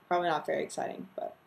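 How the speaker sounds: usual speaking voice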